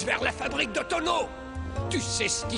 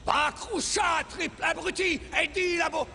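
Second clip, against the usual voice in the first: angry shouting; 6 semitones higher than usual. Acted. King yells at general.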